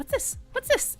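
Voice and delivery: high squeaky mock Jack voice; Jack Skellington impression. Performer creeping up on guests, high squeaky voice. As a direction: creep up whispering what's this. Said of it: "creep up to somebody and be like".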